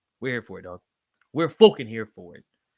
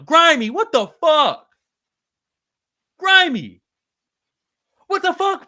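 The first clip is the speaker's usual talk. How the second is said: shocked outcry; 14 semitones higher, 9 dB louder, slower than usual. Real, not acted. Shocked friend wasn't subscribed.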